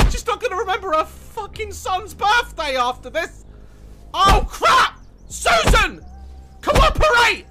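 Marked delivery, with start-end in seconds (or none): 4.1-7.5 high, loud exasperated yell; frantic shouting. Pretend. Player pleading with uncooperative game character, frantic shouting. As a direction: shout at raised pitch for cooperation.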